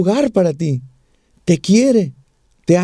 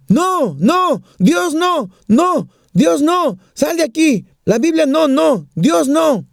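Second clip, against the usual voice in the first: panicked refusal, pitched up high; retold yelling; 7 semitones higher, slower than usual. Dying neighbour yelling at the Bible-carrying visitor, high mock yell. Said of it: "estaba gritando muy fuerte".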